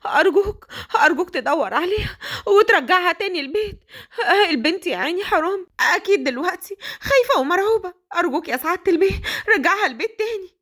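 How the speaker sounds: very high, distraught begging; frantic nanny. Acted. Nanny begging the father to bring the kidnapped girl home, frantic. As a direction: plead desperately for the girl.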